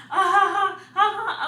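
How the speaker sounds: loud, high mock laugh; imitated laughing. Receptionist laughing at labouring woman, imitated laughter. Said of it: "He's laughing".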